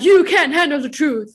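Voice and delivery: movie quote. Retold or imitated. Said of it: "where it's like".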